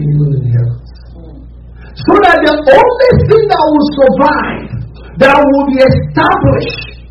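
high, urgent preaching voice pitched well up; fervent preaching. Acted. Preacher urging the congregation to pray, fervent high preaching. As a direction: preach it hard, pushing every line.

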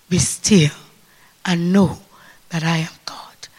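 breathy whisper of God's voice; soft whispered divine voice; hushed whisper. Acted. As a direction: whisper it like a still small voice. Voice of God speaking softly.

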